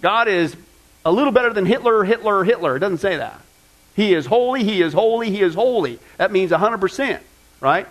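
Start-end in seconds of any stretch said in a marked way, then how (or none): 4.0-6.0 holy stressed each time, falling at the end; emphatic repetition. Shown as a demonstration. Preacher repeats holy to stress God's perfection. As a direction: land each holy firmly. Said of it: "that is for emphasis".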